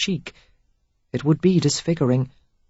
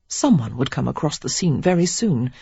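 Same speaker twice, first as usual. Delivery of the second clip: ordinary delivery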